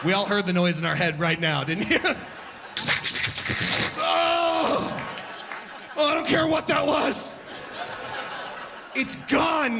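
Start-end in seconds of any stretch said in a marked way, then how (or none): 2.0-3.9 high shrieking burst of laughter. Real, not acted. Comic cracking up at the crowd, shrieking laugh. Loud laugh at noise joke. 4.0-9.8 high, shouted mock shock; mock alarm. Comic reacting to the vacuum noise, high mock yelp.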